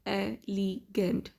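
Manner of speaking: "elegant" split slowly into e-le-gant; syllable by syllable. Shown as a demonstration. Teacher showing three syllables to learners, "elegant" slowly broken up. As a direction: sound out "elegant" with a gap after each syllable. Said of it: "it has three syllables".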